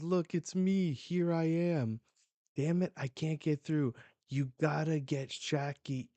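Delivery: acted urgent shout. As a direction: shout it like a fighter who can't get through. Acted urgent cry during a fight.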